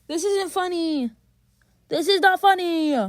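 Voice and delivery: high whine building to a loud cry; escalating protest. Real, not acted. Complaint repeated, escalating in frustration.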